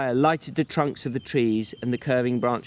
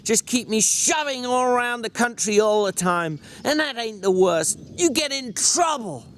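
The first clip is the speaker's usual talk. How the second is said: furious George; 10 semitones higher than usual. Acted. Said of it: "George went on furiously".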